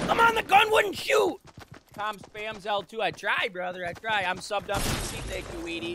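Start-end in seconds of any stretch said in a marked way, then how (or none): 0.1-1.4 loud, high, exasperated shout; frustrated yell. Real, not acted. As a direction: yell it at the broken gun. Gun fails again, yelling.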